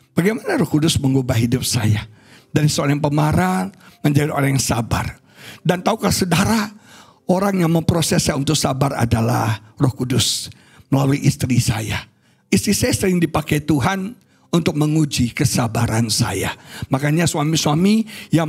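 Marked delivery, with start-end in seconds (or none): none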